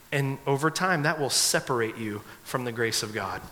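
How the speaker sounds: ordinary talk, nothing marked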